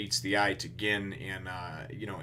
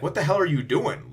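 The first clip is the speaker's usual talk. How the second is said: higher, louder indignant demand; angry outburst; 7 semitones higher, 7 dB louder than usual. Retold or imitated. Sanji yelling at Luffy, mock angry outburst. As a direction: snap at a friend who wrecked the plan.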